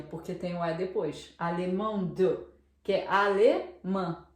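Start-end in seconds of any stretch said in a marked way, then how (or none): 1.4-2.4 Alemão de as French "al-MAN-duh"; sounded final D. Shown as a demonstration. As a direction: let the D sound clearly in Alemão de. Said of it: "Lá a gente pronuncia". (Alemão de, pronunciando o D final)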